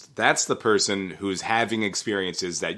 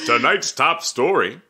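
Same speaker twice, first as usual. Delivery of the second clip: higher, louder anchorman read; newscaster voice; 5 semitones higher, 4 dB louder than usual. Acted. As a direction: announce it like the evening news. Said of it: "you also sound a lot like a good newscaster".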